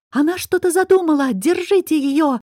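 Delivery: high alarmed yell, Anita raising the alarm. A narrator's reading. Anita yells to the girls to grab Nayra. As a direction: shout a warning to grab her. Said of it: "завопила Анита".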